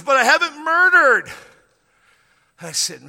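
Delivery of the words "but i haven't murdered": high, shouted retold protest; much louder, pitched-up mock outcry; loud objection. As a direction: imitate him bursting out in self-defence. Imitates man's triumphant objection.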